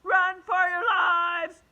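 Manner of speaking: very loud, high, slow stretched yell; frantic shout. Acted. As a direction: bellow it like fleeing in terror. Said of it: "shouted Neil".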